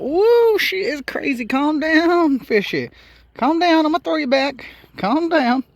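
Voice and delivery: high, loud and worked up over a thrashing catch; real excitement. Real, not acted. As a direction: call out high to settle a wild fish. Handling a wildly thrashing catch.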